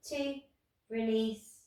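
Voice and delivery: ordinary talk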